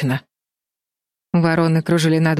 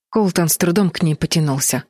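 usual speaking voice

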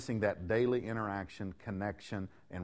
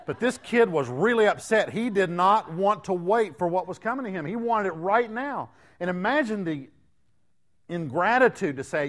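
loud, much higher impassioned preaching; preaching at full force; 11 semitones higher, 9 dB louder than usual. Acted. Pastor thundering about the ungrateful son, loud and high. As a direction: drive it home to the congregation at full voice.

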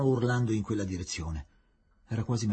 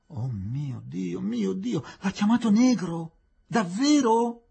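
rising from hushed gasp to loud outrage; shocked disbelief; 7 semitones higher, 3 dB louder, slower than usual. Acted. A man hearing of the insult, shock growing to outrage.

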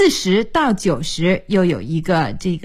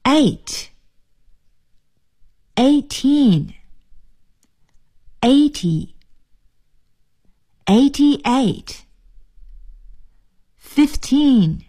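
dictation; 3 semitones higher, much slower than usual. Shown as a demonstration. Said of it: "注意听".

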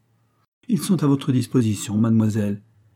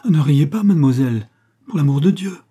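higher, louder nervous begging; nervous plea; 4 semitones higher, 7 dB louder than usual. A narrator's reading. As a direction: beg her not to laugh, frantic. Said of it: "dit Lady Farwell avec nervosité".

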